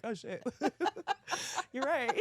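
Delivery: high, laughing through the words; laughing realization. Retold or imitated. Contestant realizing eyes can open, laughing. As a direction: crack up while retelling it.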